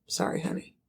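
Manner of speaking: soft unvoiced whisper, dismissive; whispered brush-off. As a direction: whisper the apology like it's nothing. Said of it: "And the mom's like".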